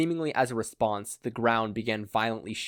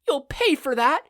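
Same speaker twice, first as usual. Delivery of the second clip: high, loud angry yell; furious high-pitched yell; 8 dB louder than usual. A narrator's reading. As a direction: scream it at him in fury. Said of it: "yelled a woman".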